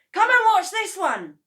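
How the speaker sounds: high, loud call across the yard. A narrator's reading. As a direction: call her out to see. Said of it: "he called out to Missus Cranky".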